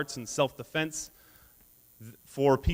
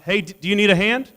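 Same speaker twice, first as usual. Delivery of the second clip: louder, higher mock call across the gym; teasing yell; 6 semitones higher, 8 dB louder than usual. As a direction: shout the jab at a buddy, half joking. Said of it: "one voice yell out".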